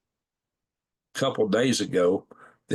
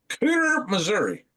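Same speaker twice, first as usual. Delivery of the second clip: amused surprise; 11 semitones higher than usual. Real, not acted. Odd town name on map.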